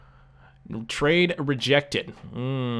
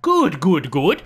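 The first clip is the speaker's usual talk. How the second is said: louder praise; 3 semitones higher, 7 dB louder, slower than usual. Real, not acted. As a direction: cheer the repeated "Good" out with more push. Repeats praise, louder second time.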